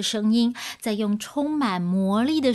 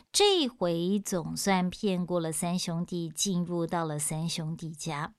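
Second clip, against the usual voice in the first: usual speaking voice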